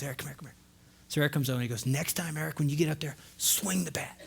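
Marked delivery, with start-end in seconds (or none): 0.0-0.5 put-on very soft, low call to a child; hushed, hurried acted-out summons; confidential beckoning. Uncle beckons boy at the fence. 1.9-4.2 put-on soft, intent instruction to a child; hushed acted-out uncle's advice; quiet coaching. Uncle kneels, coaching his nephew.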